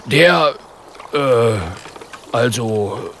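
hesitant bear's grumble, halting; grumbling. Acted. Polar bear stuck for an answer, a hesitant grumble. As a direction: stall for time, unsure. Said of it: "brummt Björn und kratzt sich am Kopf".

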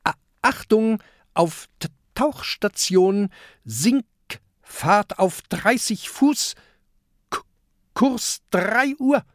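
high, slowed stuttering captain's command; stammering commands to the crew, mildly called out; calling out orders. Acted. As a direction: announce the dive like a proud skipper. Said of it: "ruft Peter".

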